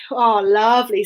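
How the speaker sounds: ordinary delivery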